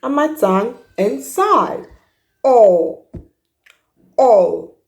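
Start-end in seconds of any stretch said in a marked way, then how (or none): 2.4-4.7 "O" with lips rounded. Shown as a demonstration. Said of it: "Watch my lips, they're rounded".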